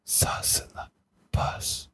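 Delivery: whispered mouth sounds; hushed whisper, crisp consonants; ASMR whisper. Pretend. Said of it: "gotta have a lot of the".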